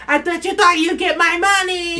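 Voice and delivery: high, loud mocking taunt; sneering jeer. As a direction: goad him from behind. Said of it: "She starts antagonizing him from the back".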